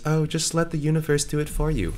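airy mockery. Mocks friends' go-with-flow attitude.

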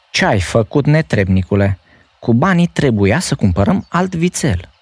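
high, sharp mother's scolding; harsh scolding. A narrator's reading. Mother scolding her son harshly. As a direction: scold the boy for wasting the money. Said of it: "mama îl certă cu asprime".

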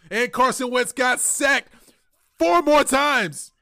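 high, loud, slow and drawn out; exasperated outburst. Real, not acted. Fan ranting about his quarterback getting sacked, exasperated. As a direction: build to an incredulous stretched-out cry. Exasperated climax of the sacks story.

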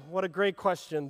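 ordinary talk

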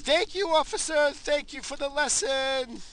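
a high, drawn-out sarcastic thank-you to the officer; mock-polite thanks. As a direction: thank the cop sweetly while mocking her. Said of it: "I'm like".

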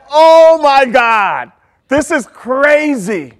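slow, drawn-out alarm, loud at the start; freaking out. Retold or imitated. Watching the boat capsize on screen, freaking out. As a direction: re-enact stunned panic, stretching every word. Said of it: "And I am freaking out".

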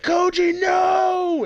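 high, loud cry of protest; retold dramatic scream; mock anguished shout. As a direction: yell out as a character watching a friend fall. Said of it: "he starts, like, screaming".

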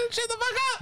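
very high, quick falsetto squawk; mock squawk. Mimicking a Howard Beach guy, mock squawk. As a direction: squeal it out in a put-on voice.

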